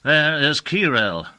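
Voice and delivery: lightly voiced haughty rival wizard, stung; understated indignant magician; Commandore's voice. A narrator's reading. Commandore presents his demon.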